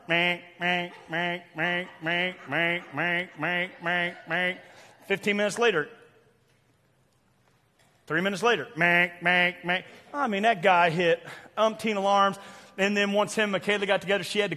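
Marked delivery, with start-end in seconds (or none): 0.1-4.5 repeated monotone alarm bleats; imitated alarm clock. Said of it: "I'd hear his alarm". 8.8-9.8 monotone repeated alarm honks; imitated alarm clock. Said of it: "I'd hear his alarm".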